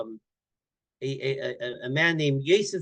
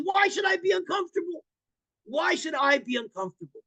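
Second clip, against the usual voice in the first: higher, emphatic repeated question; incredulous protest; 11 semitones higher than usual. Retold or imitated. Guest insisting to the rabbi he isn't uncomfortable, incredulous. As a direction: push back twice in disbelief.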